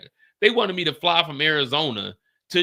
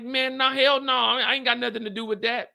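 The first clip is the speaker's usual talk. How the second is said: higher, emphatic refusal; flat refusal; 8 semitones higher than usual. Retold or imitated. Telling the lawyer no, a high emphatic refusal. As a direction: brush the lawyer off.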